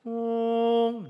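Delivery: long, drawn-out high horn note; held-back trumpet toot. Shown as a demonstration. Preacher showing the long trumpet signal, one sustained toot. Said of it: "힘을 억누르고".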